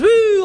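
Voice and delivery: high, drawn-out "woo"; mock woo. Guest imitating partygoers balancing on balconies, mock woo. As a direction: whoop like someone teetering on a balcony. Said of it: "balancing like this".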